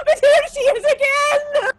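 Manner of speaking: high, loud, manic cry; crazed cry. Acted. Marlena shrieking that the ghost returned, high and loud. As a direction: shout it high, half-laughing. Madwoman crying out.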